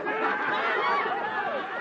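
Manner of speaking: high hearty guffaw; big laugh. Acted. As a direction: crack up at your own joke. Laughs at his own gag.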